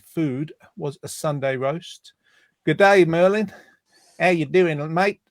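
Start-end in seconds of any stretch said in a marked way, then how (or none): 2.6-5.2 a lightly put-on, self-mocking Australian accent; rubbish Australian accent. Radio host greeting caller Merlin in a bad Australian accent. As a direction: greet him like a cheery Aussie, badly done. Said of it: "that's my rubbish".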